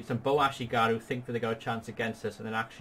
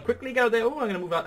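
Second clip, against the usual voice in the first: excited urgency; 11 semitones higher, 4 dB louder than usual. Real, not acted. Urgent battle commentary.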